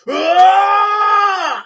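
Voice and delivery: high, loud charging war cry; battle cry. Acted. Dragonborn warrior bursting around the corner at the party, battle cry. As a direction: scream it as you charge in.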